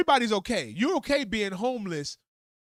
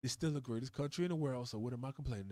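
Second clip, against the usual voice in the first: muttered, much softer grumbling to himself; mock grumble; 6 semitones lower, 7 dB quieter than usual. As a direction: grumble it under your breath, half resigned.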